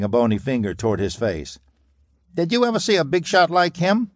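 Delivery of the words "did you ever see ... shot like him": high, sharp taunting mobster; cracking gangster jab. A narrator's reading. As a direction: jab the question at him. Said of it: "he cracked".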